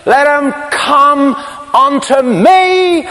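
very high, slow, ringing cry. Retold or imitated. Jesus calling to the thirsty crowd, high slow cry. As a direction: cry it out alone above the crowd. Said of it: "Not whispered, cried".

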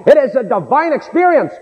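high, ringing pulpit declaration; impassioned preaching. Acted. Preacher explains new birth to his congregation, impassioned preaching. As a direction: drive each statement home at a high pitch.

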